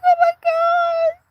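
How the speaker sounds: very high, slow "Oh my God"; amazed. Retold or imitated. Opening the iris calendar gift, in high amazement. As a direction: squeal it out in delighted disbelief. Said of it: "I'm like".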